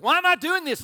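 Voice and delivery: high, loud exasperated outburst; mad at yourself. Acted. Single person arguing with themselves over the budget, mock-angry. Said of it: "You get mad at yourself".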